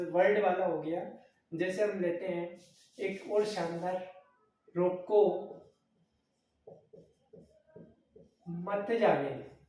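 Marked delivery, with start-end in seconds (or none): 4.8-9.6 रोको said firmly, pause, then मत जाने दो; loud रोको, a comma's pause, मत जाने दो together; pause after रोको. Shown as a demonstration. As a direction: break the sentence right after रोको. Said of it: "शानदार विराम चिन्ह का उदाहरण".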